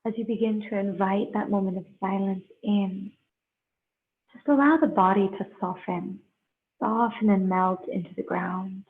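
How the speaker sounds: slow, unhurried meditation guide with long pauses; guided meditation. Real, not acted. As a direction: ease them into breath and stillness, leaving space between phrases.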